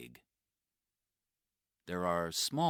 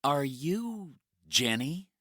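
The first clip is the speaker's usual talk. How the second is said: hopeful question; 10 semitones higher, 8 dB louder than usual. Acted. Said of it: "Mike asks".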